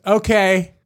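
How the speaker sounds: a lightly voiced child accepting it; kid giving up. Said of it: "and he goes".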